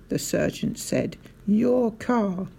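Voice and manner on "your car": calm, innocent echo; unruffled realisation. A narrator's reading. Sergeant placidly echoes the shouting owner, unruffled. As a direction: say it mildly, as if only just understanding.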